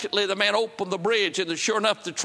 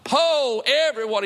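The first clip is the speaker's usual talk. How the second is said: louder, higher ringing call; 6 semitones higher, 4 dB louder, slower than usual. Acted. Preacher calls out to everyone thirsty, loud.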